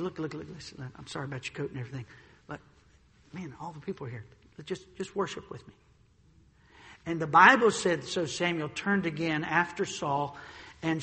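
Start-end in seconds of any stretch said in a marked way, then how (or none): 0.0-5.7 very soft, rushed, low plea; hushed pleading. Retold or imitated. Preacher voicing Saul begging Samuel in a hushed low voice. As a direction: plead under your breath so the crowd can't hear.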